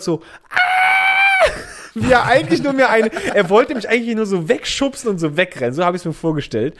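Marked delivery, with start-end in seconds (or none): 0.5-1.5 high, loud mock scream of panic; imagined panicked scream. Narrator voicing the test worker's imagined inner scream of panic. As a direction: shriek like the tester wants to run away. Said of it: "in seinem Kopf so einfach nur gehört".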